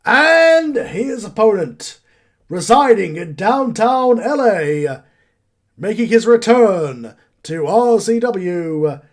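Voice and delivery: loud, stretched-out wrestling introduction; ring announcer. Acted. Game master introducing the returning wrestler, big ring-announcer voice. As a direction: belt out the entrance, drawing out every name.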